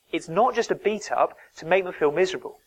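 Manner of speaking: normal talking voice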